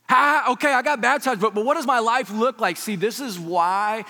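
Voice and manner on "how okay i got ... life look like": high, loud, stumbling question; mock fluster. Newly baptized lady wonders about her life, mock fluster. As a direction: imitate someone comically at a loss. Acting out the lady's worried question.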